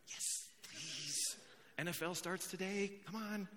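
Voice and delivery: whispered "Yes, please" then hushed pleading; half-whispered impatience for football; mock eager congregant. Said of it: "Some of you are like".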